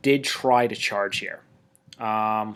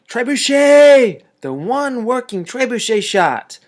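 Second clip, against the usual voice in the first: excited cheer; 11 semitones higher, 10 dB louder, slower than usual. Real, not acted. Cheering his trebuchet's lucky shot.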